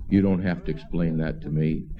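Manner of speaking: very low, level booming-God impression; mock-divine deep voice. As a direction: drop right down low, as God speaking. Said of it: "in a great big, deep voice".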